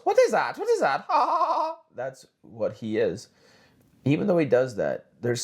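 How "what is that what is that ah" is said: squeaky high cartoon mouse voice, shrieking; mock Tucker Carlson as a cartoon mouse; Mickey Mouse Tucker.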